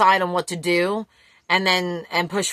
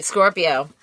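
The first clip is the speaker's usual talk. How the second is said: ordinary talk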